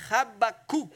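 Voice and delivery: Habakkuk firmly, with a long final "oo"; Hebrew pronunciation. Shown as a demonstration. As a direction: model Habakkuk the Hebrew way, ending in "kook". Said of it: "you say".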